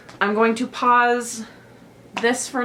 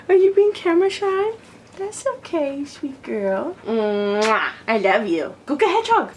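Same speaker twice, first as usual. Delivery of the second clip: pet talk; 7 semitones higher, 4 dB louder than usual. Real, not acted. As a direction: sweet-talk the shy dog, pitch up. Talking to her dog Lily.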